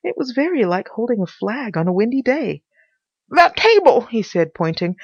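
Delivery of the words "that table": high, loud character voice; urgent Pycraft. Acted. Pycraft pointing out the table, high and urgent. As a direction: call out urgently, pitched up.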